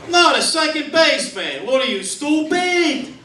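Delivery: high, indignant mock yell; yelling at screen. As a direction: heckle your clueless buddy like a sports fan. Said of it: "then he yells at the screen".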